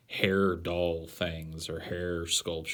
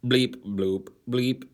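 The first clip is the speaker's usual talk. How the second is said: robot voice; 5 semitones higher, 5 dB louder, slower than usual. Pretend. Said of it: "I am a robot".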